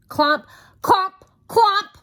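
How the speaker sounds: loud high clomps; stomping clomps. A narrator's reading. Mister Noisy stomps down the hill, loud clomps. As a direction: stamp each clomp out.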